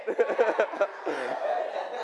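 soft amused chuckle; laugh. Real, not acted. Teacher after students try to flip it, chuckling. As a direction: chuckle at the failed trick.

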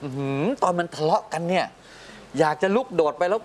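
high, animated imitation of the wife; wife's excited retelling. Husband voices his wife gushing to him after the kids leave, pitched high. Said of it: "บอกให้ผมว่า".

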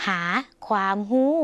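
ordinary talk, nothing marked

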